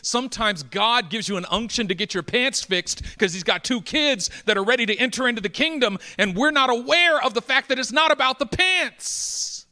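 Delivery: high, loud preacher's build to a climax; impassioned preaching. Real, not acted. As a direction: drive the point home, rising in pitch and volume. Preaches at full force, building.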